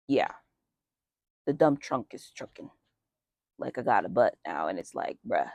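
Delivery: ordinary delivery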